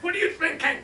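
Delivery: high, loud alarmed cry; frantic plea. Retold or imitated. Debbie desperately pleading with Gina not to jump. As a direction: beg her to stop.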